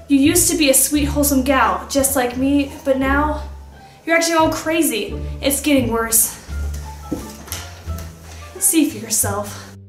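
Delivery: ordinary talk, nothing marked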